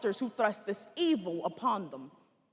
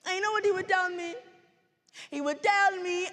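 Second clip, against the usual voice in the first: animated, higher-pitched dramatic reminiscence; genocide survivor; 5 semitones higher than usual. Acted. Survivor showing her family to the audience, fond storytelling.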